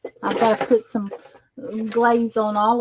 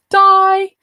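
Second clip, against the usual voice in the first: mock cry of doom; 9 semitones higher, 6 dB louder than usual. Mock dying near hot glue gun.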